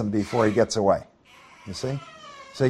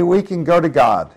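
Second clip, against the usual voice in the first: ordinary delivery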